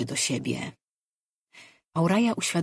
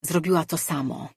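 ordinary delivery

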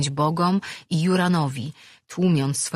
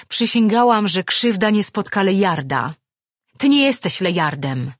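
loud rebuttal, starting high and coming down; angry retort; 4 semitones higher, 6 dB louder than usual. A narrator's reading. Attacking woman denies he is Lejard, angry retort. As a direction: throw the accusation back forcefully.

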